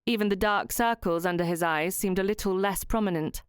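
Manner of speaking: ordinary delivery, nothing marked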